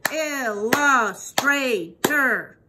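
ILLUSTRATOR very slow, broken into four beats; "Illustrator" chanted slowly in separate syllables. Shown as a demonstration. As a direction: clap out "Illustrator" one drawn-out syllable at a time. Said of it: "Let's clap that".

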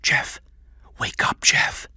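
urgent stage whisper. A narrator's reading. Kathy secretly wakes the boy, whispering. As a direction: hiss a wake-up call under the breath. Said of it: "touched Jeff on the shoulder and whispered".

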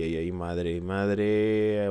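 ordinary delivery

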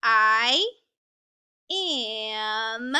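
each word stretched long, with a clear pause between; reading word by word. Shown as a demonstration. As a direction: read I and am slowly, one at a time. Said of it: "So let's read it".

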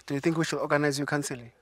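ordinary talk, nothing marked

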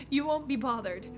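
ordinary delivery

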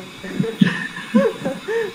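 high-pitched burst of laughter; amused laugh. Real, not acted. Teacher teasing a student about an old calculator, amused laugh. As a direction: laugh out loud, pitched well above speech.

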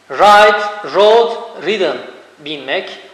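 ordinary talk

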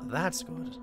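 voice cracking. Real, not acted. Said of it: "My voice cracked".

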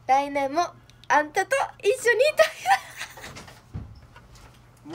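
loud, high Kansai-style あんたと一緒にいたいな; excited Kansai confession; Kansai sweet line. Shown as a demonstration. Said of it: "そこはあんたやろ".

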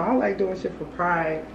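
ordinary talk, nothing marked

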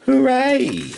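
very high, loud hooray; cheering cry. A narrator's reading. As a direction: cheer like a crew of delighted cats. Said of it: "and the cats cried".

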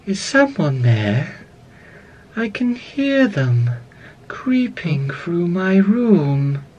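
slow, drawn-out, eerie doll voice; Ballora. Acted. Animatronic listening for an intruder in her room, slow eerie voice. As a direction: stretch each phrase out, lilting and unhurried. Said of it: "very softly".

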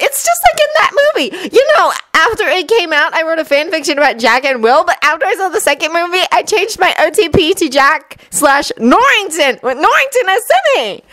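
high, gushing, breathless fan rant; excited fangirl. A narrator's reading. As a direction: geek out, racing ahead. Fangirl gushing about pirates.

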